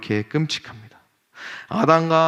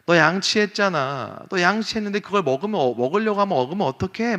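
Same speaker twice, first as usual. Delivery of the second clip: higher-pitched mock scolding of a child; scolding mother; 7 semitones higher than usual. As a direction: imitate a mom scolding her son. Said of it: "막 이렇게 다그치는 거예요". (아이를 다그치는 엄마 흉내)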